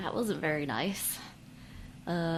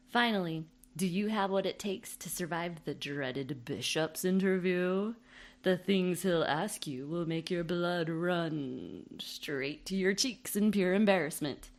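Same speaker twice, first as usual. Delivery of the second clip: spoof ad read; 7 dB louder than usual. Acted.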